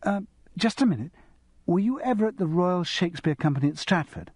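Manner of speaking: a hint of a bright young girl, a little fast; lightly voiced eager schoolgirl. A narrator's reading. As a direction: nudge the voice up into an excited teenager. Narrator voicing young Felicity.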